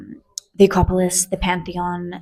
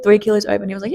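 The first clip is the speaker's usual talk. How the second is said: usual speaking voice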